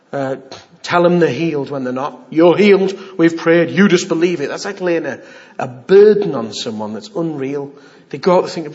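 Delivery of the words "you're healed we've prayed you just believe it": loud, forceful pronouncement; mock pushy insistence. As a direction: declare it loudly, brooking no doubt. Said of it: "I'm not saying to you".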